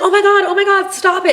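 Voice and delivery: high, loud mock panic; shrieking stop it as he grabs her; panicked plea. Woman pleading with boyfriend to stop, high panicked. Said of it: "And she's like".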